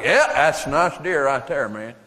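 put-on mild Southern accent admiring a deer. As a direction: savour it in a light Southern accent. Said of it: "Yankees can talk like Southerners".

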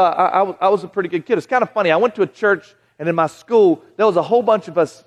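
usual speaking voice

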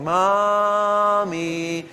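put-on child calling out. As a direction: call for a parent in long drawn-out notes. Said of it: "They should be calling".

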